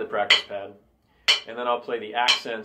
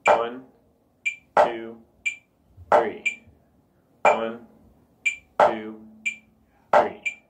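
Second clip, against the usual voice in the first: each number alone, long even gaps between; evenly spaced count; 3 dB louder, much slower than usual. Shown as a demonstration. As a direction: count three slow, equal beats. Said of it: "are evenly spaced".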